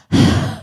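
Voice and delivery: a sob that is only air; airy crying sob. Shown as a demonstration. Showing the crowd how her crying sounded, airy sob. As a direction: push out a breathy sob with no voice. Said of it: "Sounds like this".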